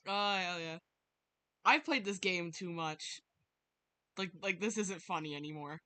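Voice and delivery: normal talking voice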